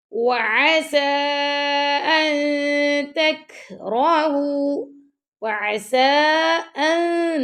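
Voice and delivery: very slow melodic chant; Quran recitation. Shown as a demonstration. Teacher recites for pupils, slow melodic chant. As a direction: stretch the vowels in recitation. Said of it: "teacher demonstrates recitation".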